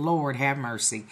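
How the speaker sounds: low, soft mutter under the breath; a quiet aside, half to herself; muttering to self. Retold or imitated. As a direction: mutter it to yourself in disbelief. Inner thought, muttered.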